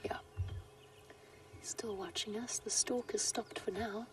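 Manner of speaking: soft hushed safari whisper; wildlife watching whisper. Real, not acted. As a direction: keep it to a whisper near the cat. Cat watching the vehicle during its hunt.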